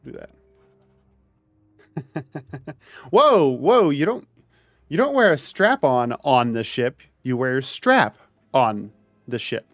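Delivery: ordinary delivery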